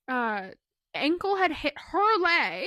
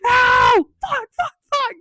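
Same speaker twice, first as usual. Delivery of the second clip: high, loud mock yell of pain; imitated pained scream; 10 semitones higher, 10 dB louder, slower than usual. Dad cramping in the parents' bedroom, mock scream. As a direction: imitate a scream, then rapid high swearing. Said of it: "I heard a scream come".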